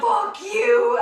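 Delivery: loud, high-pitched cursing; hammed-up indignant yell; retold angry retort. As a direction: shout it back at him, half laughing. Retold angry retort while being led off.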